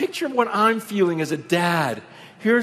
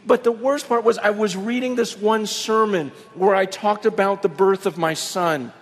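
ordinary talk, nothing marked

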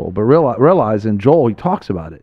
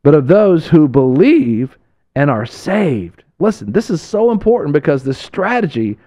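fiery preaching; 6 semitones higher than usual. Acted. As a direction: preach it to the congregation with fire. Preaching at full force.

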